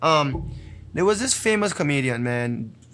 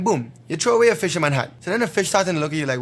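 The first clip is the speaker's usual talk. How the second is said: normal talking voice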